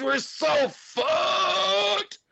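high, slow, panicked yell; panicked shout. Acted. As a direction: scream it in total despair. Morty panics over dream-traveling monster.

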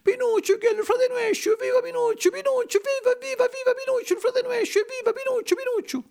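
very high falsetto puppet cheering; excited squeaky hurrahs, tumbling over each other. A narrator's reading. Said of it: "gridara tutti i pupi".

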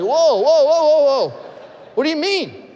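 high, loud startled whoas with a laugh; alarmed whoa. Retold or imitated. Pastor retelling his alarm at the news, startled and loud. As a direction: jump up in alarm, half laughing.

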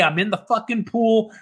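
normal talking voice

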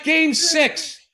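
high-pitched acted retort; exasperated retort. Telling his laughing buddy what upset him, exasperated retort. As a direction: throw it back as the obvious answer.

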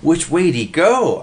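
high, loud squeak. A narrator's reading. Oscar hunts the escaping fly, squeaking. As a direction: squeak it in urgent excitement. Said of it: "Oscar squeaked".

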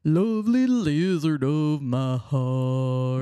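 put-on slow, sung southern accent. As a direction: croon it with a southern twang. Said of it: "Why the southern accent?".

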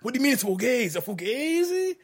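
high, loud mock-gangster questioning in an Italian-American accent; Pacino question voice. Mocking how every line becomes a question, in mock Italian-American. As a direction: drag out Fugazi way up high like an indignant mobster. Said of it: "everything's a fucking question".

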